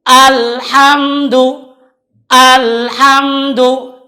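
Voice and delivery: Alhamdu with slight bounce; Alhamdu, small push on m. Shown as a demonstration. (Alhamdu dengan sedikit unjatan)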